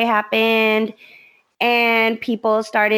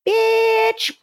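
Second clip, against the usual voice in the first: high, snotty sneer, much higher than her own voice; mock snotty customer; 13 semitones higher than usual. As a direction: spit it out like a rude bar customer. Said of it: "And she was just like".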